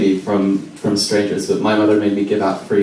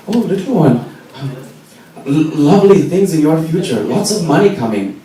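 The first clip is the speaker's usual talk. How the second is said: fortune teller; 9 semitones higher, 4 dB louder than usual. Pretend. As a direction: play the mystic reading a palm for the little one.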